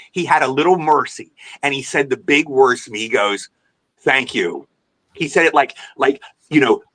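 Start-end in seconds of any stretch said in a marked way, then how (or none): 4.0-4.7 low, patronizing thanks; cold, emotionless. Retold or imitated. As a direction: imitate a star's grudging, icy thanks. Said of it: "and so emotionless".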